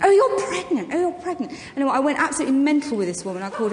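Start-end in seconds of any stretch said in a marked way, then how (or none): 0.0-1.5 higher, scornful repetition; mocking posh lady. Posh shopper mocking the pregnant narrator, scornful. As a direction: sneer it back like a snob. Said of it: "she went".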